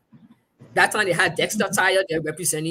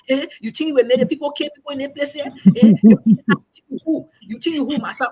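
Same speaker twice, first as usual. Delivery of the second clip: higher, loud, far-off mocking scold; scolding colleagues; 6 semitones higher, 7 dB louder than usual. Male colleagues scolding the reporter in the corridor, loud mocking. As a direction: rail at her as if she's full of herself.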